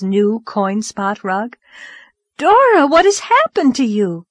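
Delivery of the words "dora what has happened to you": high, loud alarmed call; alarmed cry. A narrator's reading. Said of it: "cried Anne".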